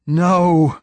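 high loud scream of no. A narrator's reading. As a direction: scream in agony. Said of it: "screamed the poet".

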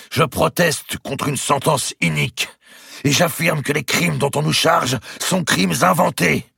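high, forceful public denunciation; powerful protest. A narrator's reading. Grand master denounces the sentence before the tribunal, powerful protest. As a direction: declaim it with full conviction. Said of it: "cette voix était si puissante".

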